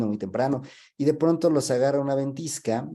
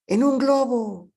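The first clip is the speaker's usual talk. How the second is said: high, loud, drawn-out holler back; slow sing-out shout, pitched way up; shouted answer; 12 semitones higher, 5 dB louder, slower than usual. Acted. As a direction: call it up to the sky as a joke punchline. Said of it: "le empiezan a gritar".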